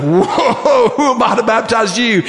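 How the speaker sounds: a laughing whoa of disbelief; amazed protest. Acted. John balks at baptizing Jesus, laughing in surprise. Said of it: "And John's like".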